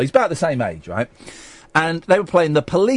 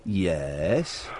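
normal talking voice

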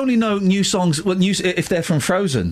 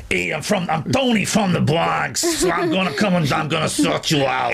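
hammed-up Bronx tough guy, lower and drawn out; American accent; 3 semitones lower than usual. Shown as a demonstration. Host showing colleague a Bronx American accent, hammed up. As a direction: put on a mock New York accent. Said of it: "give us your best American accent".

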